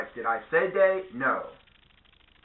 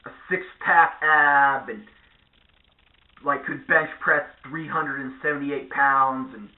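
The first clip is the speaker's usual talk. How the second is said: slow mock boast; slower than usual.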